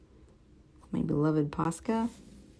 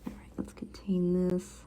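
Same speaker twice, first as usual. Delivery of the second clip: whisper; 2 semitones higher, 8 dB quieter than usual. Real, not acted. Quiet focus while drawing.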